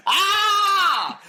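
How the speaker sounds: high piercing scream of shock; startled scream. Retold or imitated. Seeing the stranger exposed, a loud startled scream. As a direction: shriek in sudden fright.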